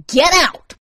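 loud, high urgent command; furious, urgent dismissal; angry order. A narrator's reading. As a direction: drive the intruder out. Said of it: "he hissed".

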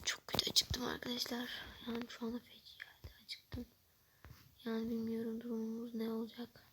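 hungry whispered complaint. Real, not acted. Starving vlogger whispering to viewers while waiting. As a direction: moan it softly to the lens.

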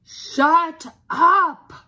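high, very loud outburst; furious shout. Acted. Family fed up with the talking dog, shouting. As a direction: explode to cut the chatter off.

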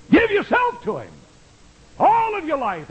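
high, ringing call to give yourself; soaring pulpit plea; fervent exhortation. Acted. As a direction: lift the voice high to urge the crowd. Preacher's peak exhortation.

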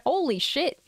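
high, stunned gasp of disbelief; shocked exclamation. Real, not acted. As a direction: blurt it out, genuinely shocked. Reacts to the violent scene.

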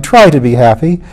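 emotional outburst, building to a high finish; impassioned cry. A narrator's reading. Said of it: "he cried".

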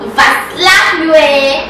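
mock threat. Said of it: "I go land you slap".

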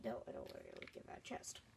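whispering. Real, not acted. Whispered aside.

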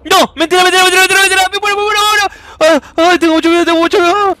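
loud, frantic terrified yelling. Real, not acted. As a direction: scream in terror, rushing. Terrified while being chased.

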